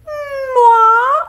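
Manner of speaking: high, loud diva puppet voice; Miss Piggy. Pretend. Vlogger voices the Miss Piggy puppet to viewers, high and loud. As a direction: play the grand diva pig. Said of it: "It's a Miss Piggy puppet".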